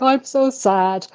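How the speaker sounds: a mildly mocking sad voice; mock sad self. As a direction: play your old moping self. Voicing own past sad self.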